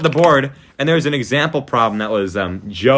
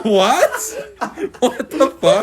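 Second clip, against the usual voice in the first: mock shock; 11 semitones higher, much slower than usual. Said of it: "And we're like".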